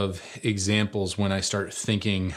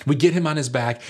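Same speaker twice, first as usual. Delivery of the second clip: ordinary talk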